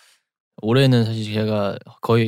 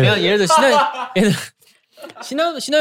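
laughing; 12 semitones higher than usual. Real, not acted. Cracks up mid-sentence.

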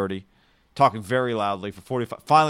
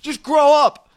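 high, loud imitated outburst; exasperated retort; 14 semitones higher, 8 dB louder, much slower than usual. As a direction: snap it loudly at another passenger. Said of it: "the guy with the accent".

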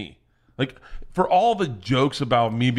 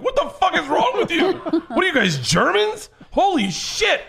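much higher and laughing, appalled disbelief; a stunned father cracking up at his kid's gross trick; shocked laughing outburst; 4 dB louder than usual. Retold or imitated. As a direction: shriek it through laughter like a horrified dad. Dad shocked by daughter's trick.

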